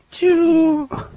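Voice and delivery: high squeaky squirt sound; a mock oil-can squirt; oil squirt noise. Oiling the robot arm.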